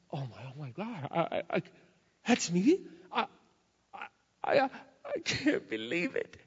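whimpering, broken, halting disbelief; mock sobbing. The man seeing his new face, voiced tearfully. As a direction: act out the guy's tearful stammer. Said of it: "he just starts to sob".

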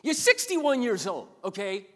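high, loud, exasperated telling-off; hammed-up scolding, punching the key words; mock scolding God. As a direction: play an exasperated God dressing down a pastor. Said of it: "he looks at you and goes".

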